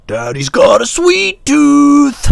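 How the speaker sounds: high, slow, drawn-out screech; loud, stretched falsetto caricature; morphed creepy daddy voice. Retold or imitated. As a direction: screech it slowly like a movie monster. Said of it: "I morphed it into".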